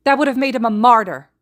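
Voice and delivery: high, loud screamed retort; shouting match, screaming in fury; furious scream. A narrator's reading. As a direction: scream it back at him in rage. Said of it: "Izuku screams back".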